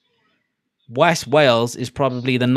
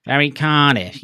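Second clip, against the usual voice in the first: broad Cardiff accent, louder; 2 semitones higher, 7 dB louder than usual. Shown as a demonstration. Streamer showing own Welsh accent, broad Cardiff. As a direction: lean into the Cardiff vowels. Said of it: "You've got my accent, which is".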